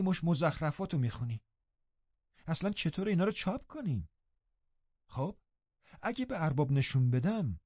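mild stern rebuke; stern scolding. Acted. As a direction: scold the girl seriously. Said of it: "همانطور جدی گفتم".

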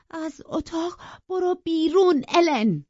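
loud, high, drawn-out command; trembling with rage. A narrator's reading. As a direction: order the servant out at full voice.

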